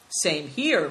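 ordinary delivery

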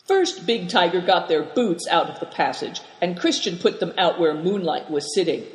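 plain reading voice

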